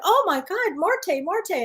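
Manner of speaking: mock scream at normal volume; retold scream. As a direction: act out a shrieked exclamation without really shouting. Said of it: "and I screamed".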